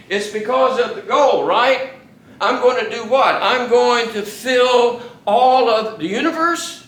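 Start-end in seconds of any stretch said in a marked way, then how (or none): 2.4-6.8 mock incredulous. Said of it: "Is that what he says?".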